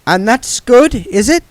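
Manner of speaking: high, lightly voiced old witch feigning innocence; Granny's voice. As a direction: play the sweet innocent old lady.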